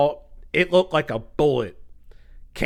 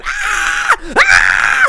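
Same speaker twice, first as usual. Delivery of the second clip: very high mock scream; imitated screams; 6 dB louder than usual. Imitating girls showered with glass, high screams. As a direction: shriek like startled girls, hammed up. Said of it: "and they're yelling".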